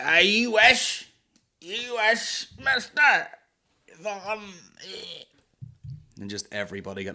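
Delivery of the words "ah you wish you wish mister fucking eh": put-on very high, slow, loud drunken taunt; drunk heckler. Drunk woman taunting comedians about dirty jokes, shrieking and slow. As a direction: drag it out like a tipsy flirt. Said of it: "she'd be like".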